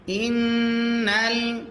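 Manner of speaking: ইন্নাল drawn out, nasal ghunnah. Shown as a demonstration. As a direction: hold the নু of ইন্নাল in the nose. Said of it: "গুণনা করা ওয়াজিব হয়".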